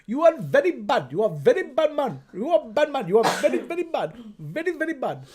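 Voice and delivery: scolding in a put-on Indian accent; hammed-up Indian accent. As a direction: chant the reproach with a bouncy Indian lilt.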